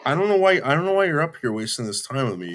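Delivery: fed up, demanding why; exasperated. Real, not acted. Telling the caller off for wasting time, exasperated. Arguing on a call.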